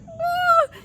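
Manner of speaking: high mock yelp of fright; sudden high shriek of alarm; startled cry. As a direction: squeal it like a scared teen. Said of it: "and I was like".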